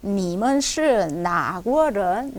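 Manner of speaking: 你们是哪国人 said very slowly, rising at the end; 你们是哪国人 word by word; 천천히 또박또박 성조; slow tonal demo. Shown as a demonstration. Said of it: "정식으로 하면 你们是哪国人".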